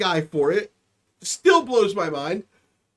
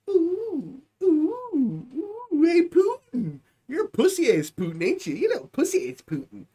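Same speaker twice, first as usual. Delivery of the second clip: put-on childish taunting; 7 semitones higher than usual. Taunting Putin, poking the bear.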